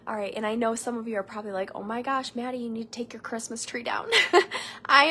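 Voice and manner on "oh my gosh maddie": usual speaking voice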